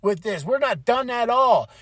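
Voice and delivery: retold indignant challenge; angry confrontation. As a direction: imitate a wrestler picking a fight. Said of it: "he's angry, he's mad".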